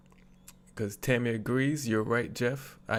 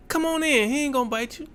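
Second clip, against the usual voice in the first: mock breezy owner, pitched much higher and louder; careless dog owner; 12 semitones higher, 5 dB louder, faster than usual. Dog owner waves the visitor in, much higher mock voice. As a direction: toss it off like the dog's harmless. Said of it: "the owner of the dog and the house says".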